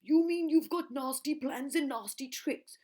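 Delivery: high, loud, slow indignant; Trunky elephant. Acted. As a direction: sneer at the plans. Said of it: "said Trunky".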